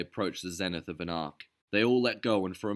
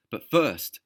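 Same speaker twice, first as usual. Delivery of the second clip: higher, louder resolute voice; determined vow; 5 semitones higher, 7 dB louder than usual. A narrator's reading. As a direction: promise it fiercely. Derville continuing vow.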